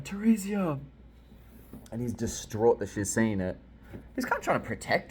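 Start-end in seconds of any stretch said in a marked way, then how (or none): 0.0-0.8 high mock shout of a name; imitated shout. Dad shouting at his daughter, imitated shout. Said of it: "he's, like, shouting".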